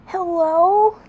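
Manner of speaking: high, bright little fox voice on the phone; Tails voice. Acted. Tails picking up the phone, in a high voice. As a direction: squeak it like young Tails. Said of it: "That's the best way I can do his voice".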